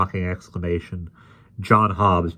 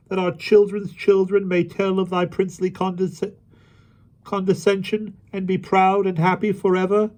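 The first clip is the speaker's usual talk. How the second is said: ironic high-pitched wailing plea; mocking supplication; 14 semitones higher, 4 dB louder than usual. A narrator's reading. Kneeling rabble begs the mock king for favour, mocking wail. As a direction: wail it high in sham worship. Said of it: "ironical wailings and mocking supplications".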